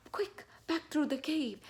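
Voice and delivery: high-pitched, hurried storybook alarm; playful panicky urgency; urgent. Shown as a demonstration. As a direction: rush it as if fleeing a bear. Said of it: "go through this very quickly, like it's urgent".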